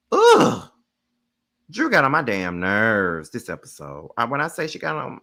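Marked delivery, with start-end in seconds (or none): 0.1-0.7 high loud groan of disgust; disgusted ugh. Real, not acted. Host groaning at Drew, disgusted. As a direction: groan like you can't stand her.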